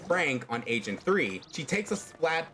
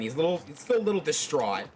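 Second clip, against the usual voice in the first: ordinary delivery, nothing marked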